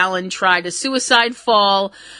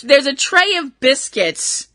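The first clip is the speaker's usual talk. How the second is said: normal talking voice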